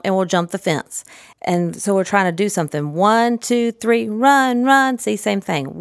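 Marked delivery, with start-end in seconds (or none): 2.9-5.0 sing-song count. Shown as a demonstration. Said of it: "same kind of intonation".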